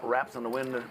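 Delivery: usual speaking voice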